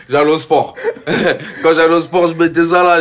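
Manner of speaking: giggling as he tells it; telling a gym memory, laughing high and loud. Real, not acted. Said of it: "laughing while telling".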